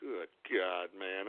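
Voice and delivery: exhausted disbelief; weary mutter. Acted. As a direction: groan it under your breath. Exasperated aside.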